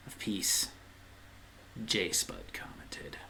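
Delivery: hushed, slow dramatic whisper. Acted. Announcing the dreaded commenter to viewers, in a slow whisper. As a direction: breathe it like a dread omen is coming.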